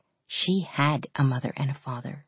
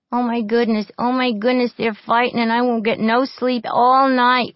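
high, loud whiny fretting; whining; 8 semitones higher, 7 dB louder than usual. A narrator's reading. Frightened little girl whines about the fight, high and loud. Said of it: "whined Tessie".

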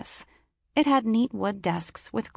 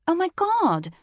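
surprised; 11 semitones higher, 5 dB louder than usual. A narrator's reading. Startled office woman.

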